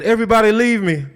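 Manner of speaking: commanding order. Acted. Joseph orders everyone out.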